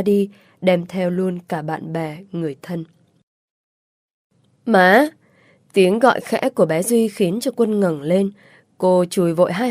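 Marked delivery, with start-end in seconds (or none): 4.7-5.1 a small son calls his mother, louder; child calling out. A narrator's reading. Son wakes and calls his mother, raised voice. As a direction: call Má like a little boy.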